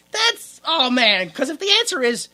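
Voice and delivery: ordinary talk, nothing marked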